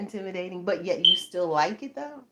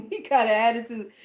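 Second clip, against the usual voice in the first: ordinary delivery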